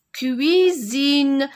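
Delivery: "cuisine" stretched, with a long clear "ui" glide; drawn-out clear "ui". Shown as a demonstration. Teacher telling cuisine from cousine, drawn-out clear "ui". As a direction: hold both syllables of "cuisine", ui not ou. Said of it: "Ce n'est pas une cousine".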